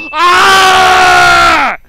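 high, very loud sustained yell; long frustrated scream. Real, not acted. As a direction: scream at full volume and hold it. Dying in the game.

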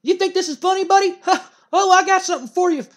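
a manager losing it at the wrestler; furious manager rant. Retold or imitated. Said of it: "And Jim Cornette goes".

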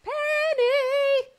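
Penny hollered very high and long; dinner call. Shown as a demonstration. Calling her cohost in to dinner like a dinner bell. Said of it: "It's like the dinner bell".

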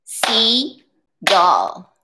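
"Seagull" split in two, sea, pause, gull; careful syllable-by-syllable "Seagull", long gap after "sea"; two separate syllables. Shown as a demonstration. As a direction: say "Seagull" in two distinct beats. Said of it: "or two claps".

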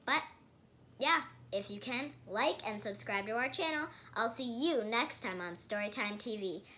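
loud, high sign-off; upbeat outro. Acted. Kid host signing off to her viewers, loud. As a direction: wave goodbye to the viewers.

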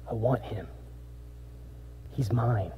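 very soft and low, intimate; tender hush. Acted. God claiming the sinner as his own, tenderly soft. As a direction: say it like a lover's promise.

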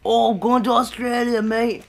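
loud, high, slow fake Aussie; mock Australian accent. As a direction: holler in an overdone Australian accent. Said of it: "that's how Australians talk".

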